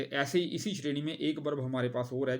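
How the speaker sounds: ordinary delivery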